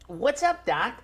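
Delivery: high-pitched cartoon rabbit catchphrase; Bugs Bunny. Shown as a demonstration. Comic showing off an impression on request, cartoon rabbit voice. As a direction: do a soft, high cartoon wisecracker. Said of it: "me actually doing my best Bugs Bunny".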